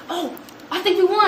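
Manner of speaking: high, thrilled realization; excited. Retold or imitated. Teacher realizing students won contest, excited. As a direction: pitch it up with dawning excitement. Retold dawning realization of winning.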